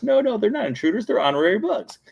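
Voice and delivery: high-pitched pleading bug voice; Flick protesting. Retold or imitated. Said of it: "even though Flick's like".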